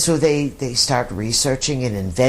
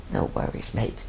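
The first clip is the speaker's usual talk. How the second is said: "No worries, mate" in a soft whisper; 6 semitones lower, 8 dB quieter than usual. Retold or imitated. Host echoing the Aussie saying to listeners, whispered. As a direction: breathe "No worries, mate" as a quiet echo. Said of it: "The Aussies have a great, uh, they have a great expression".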